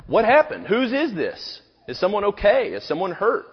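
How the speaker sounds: acted bewildered concern; alarmed questioning. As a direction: fire off worried questions at a shocking sight. Imagined alarmed questions on seeing blood.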